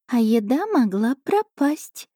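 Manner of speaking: high, slow purring cat voice; a smug little cat savouring her dinner; chatty cat familiar. A narrator's reading. Cat familiar telling princess about dinner, high slow voice. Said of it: "без зазрения совести заявила кошка".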